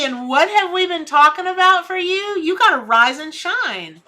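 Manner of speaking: rousing encouragement; excited pep talk. Real, not acted. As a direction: pump them up.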